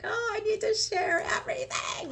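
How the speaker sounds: put-on giddy kid who can't keep a secret; like a little kid. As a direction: burst out like an eager child. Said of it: "like a little kid".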